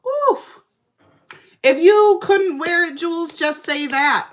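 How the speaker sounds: high, loud, shrill-free squeal then teasing call-out; scandalized ooh. Retold or imitated. Calling out Jules over her outfit, loud and high. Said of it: "And then I was like".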